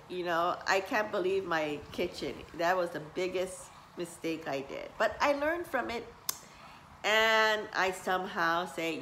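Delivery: usual speaking voice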